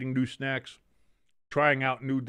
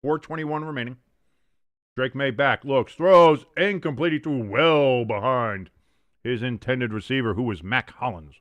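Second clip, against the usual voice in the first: play-by-play; 4 dB louder than usual. Acted. Play-by-play call of the game.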